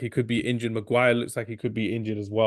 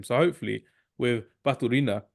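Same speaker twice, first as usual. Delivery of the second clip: ordinary talk, nothing marked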